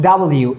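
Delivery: "ডাবল ইউ" as double plus you; correct W. Shown as a demonstration. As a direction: say "ডাবল ইউ" as two clear words. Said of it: "এইভাবে আমরা উচ্চারণ করবো". ("ডাবল ইউ", ডাবল আর ইউ মিলিয়ে)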